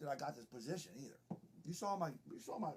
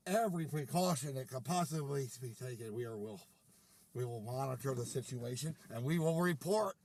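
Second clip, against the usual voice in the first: deliberate official pledge, lower at first; mock safety commander; 6 semitones lower than usual. Commander promises gentlemen to monitor the situation, mock-official.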